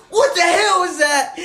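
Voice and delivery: high, loud yell with laughter; teacher yelling through laughter; laughing teacher shout. Retold or imitated. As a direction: shout it while cracking up. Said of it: "And Guthrie goes".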